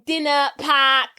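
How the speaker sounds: slow mock Boston accent, R's dropped. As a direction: draw out an exaggerated Boston accent. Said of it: "extenuate those fucking R's".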